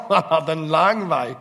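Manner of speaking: spoken through a loud laugh; words still shaking with laughter. Real, not acted. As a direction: keep laughing as you say it. Still laughing after joke.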